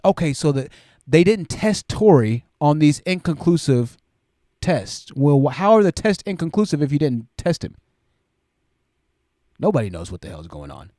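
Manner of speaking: normal talking voice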